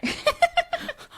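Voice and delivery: very high-pitched burst of giggles; high laugh. Real, not acted. Host looking at the cards she pulled, high giggle.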